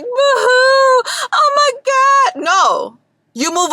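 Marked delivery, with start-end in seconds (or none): 0.0-2.4 mock crying. Mocking people who cry over car accidents, hammed-up fake sob. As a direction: wail it like an overdone crybaby. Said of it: "Am I over here crying like".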